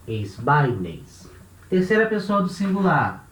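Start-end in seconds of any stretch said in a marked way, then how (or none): 0.1-1.3 "Esbaines" dito devagar, tônica em "bai", voz mais grave; pronúncia grega modelo. Shown as a demonstration. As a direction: pronuncie "Esbaines" com clareza de professor, tom baixo. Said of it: "Aí sim, juntei tudo". (professor demonstra a forma grega "Esbaines" aos alunos, pausado e grave)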